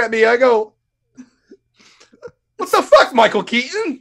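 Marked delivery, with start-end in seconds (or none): 2.6-4.0 mock outraged yelp; high shocked yell. Yelling at the doctor.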